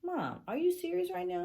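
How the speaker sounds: high-pitched, loud incredulous question; retold disbelief. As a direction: squeal it in exasperated disbelief. Retold disbelief at crying mom.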